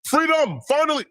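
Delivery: very high mock Nigerian accent; sped-up Nigerian accent. Host quoting the podcast joke, high mock Nigerian accent. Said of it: "when he sped up the Nigerian accent, he says".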